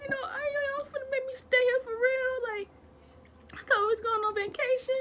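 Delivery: high, loud, wailing sobbed plea; mock crying child. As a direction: whine it through fake tears like a scared kid. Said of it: "Like, I'm crying".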